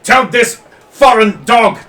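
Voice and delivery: high, loud outraged shouting; raging noble. Acted. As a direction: rage at the foreign dog through the translator. Noble raging through a translator.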